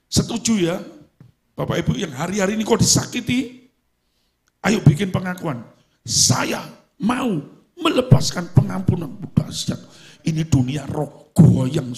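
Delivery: loud rousing preaching; preaching at full force. Acted. Preacher rallying hurt congregants to declare forgiveness. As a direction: stir the congregation up.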